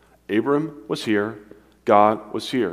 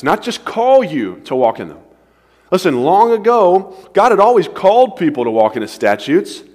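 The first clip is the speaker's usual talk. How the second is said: rising fervor; 8 semitones higher, 8 dB louder than usual. Real, not acted. Preacher builds to climax.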